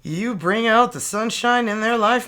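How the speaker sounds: ordinary talk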